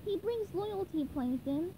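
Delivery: high, soft reassurance; gentle high character voice; cartoon sponge voice. A narrator's reading. SpongeBob defends his friend.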